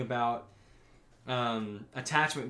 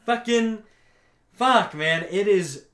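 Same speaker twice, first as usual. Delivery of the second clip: real exasperated outburst; 6 semitones higher, 8 dB louder, slower than usual. Real, not acted. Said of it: "own reaction to scene".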